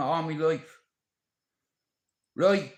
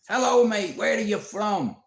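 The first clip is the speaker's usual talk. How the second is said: higher, louder mock reporter with a microphone; cheeky interviewer; 6 semitones higher, 6 dB louder than usual. Vlogger mocking a fight-week interview, loud and high. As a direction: bounce up to a stranger, mic out. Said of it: "with my little microphone going like that".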